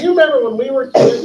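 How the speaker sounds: mock-sly reminder to an old friend; gloating taunt. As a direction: savor the payback. Payback for childhood prank.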